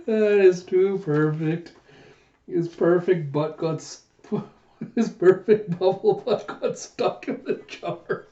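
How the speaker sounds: high, slow, through laughter; amused commentary broken by laughs; laughing. Real, not acted. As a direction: barely get the words out for giggling.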